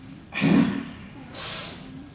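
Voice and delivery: long breathy sigh out; heavy sigh. Real, not acted. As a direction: let out a weary sigh. Sighs in grief for lost friend.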